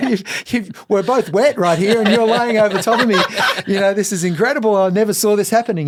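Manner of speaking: high, laughing disbelief. Real, not acted. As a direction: laugh through the words in delight. Laughing recalling his dream moment.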